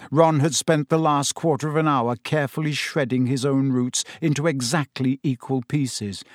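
plain reading voice

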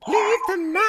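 high excited shout; hype shout. Acted. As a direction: belt the name like an announcer. Host hyping band name.

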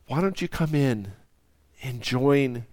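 soft, hushed invitation fading to a whisper. Acted. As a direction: coax him in gently. Father's closing invitation, hushed.

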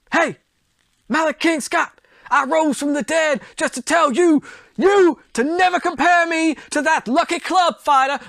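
Muhammad Ali impression. Ali from beyond the grave taunting Malik King Scott, high brash trash talk. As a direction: hype the taunt up like a showboating champ. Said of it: "trying to do a Muhammad Ali impression".

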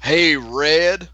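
mock country accent, a bit loud; country boy accent. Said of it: "The country boy accent?".

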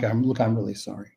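quick, low, quiet apology; softened apology. Shown as a demonstration. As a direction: drop the voice and apologize softly. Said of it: "soften it and say".

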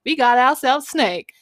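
high, loud cowboy whoop; yeehaw. Retold or imitated. Kids celebrate the kill.

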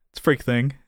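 usual speaking voice

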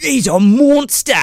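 high, slow mock Australian awe; Steve Irwin. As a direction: stretch it out like an awed wildlife host. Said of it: "he starts talking about it like".

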